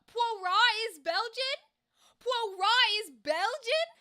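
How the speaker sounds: high, loud, drawn-out incredulous question; big shocked repeat; astonished disbelief. Real, not acted. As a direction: exclaim it slowly, stunned by the news. Shocked at learning Poirot is Belgian.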